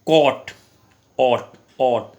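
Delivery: कॉट with a stressed open ऑ; teacher demonstrating a vowel; aw sound. Shown as a demonstration. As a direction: isolate ऑट loudly. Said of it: "ओ की आवाज़ ये है".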